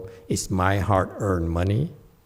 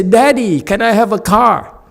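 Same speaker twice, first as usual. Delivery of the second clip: put-on high, louder whiny child asking daddy; imitating pleading child; 10 semitones higher, 7 dB louder than usual. Monks and nuns as kids begging daddy for a car. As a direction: beg like a small kid. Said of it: "they say".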